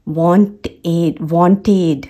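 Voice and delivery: "wanted" with a full "-ed" syllable, ending /ʋɔnʈeɖ/; "wanted" with the -ed fully sounded. Shown as a demonstration. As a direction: say "wanted" as want-ed, the final d clear. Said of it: "आप ईडी का जो sound लेके आते हैं, एड".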